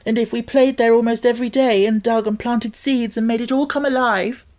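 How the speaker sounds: high, loud, tumbling rush of hope; eager girl pleading. A narrator's reading. Mary persuading Colin to keep the garden secret, eager. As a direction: pour out the whole dream at once.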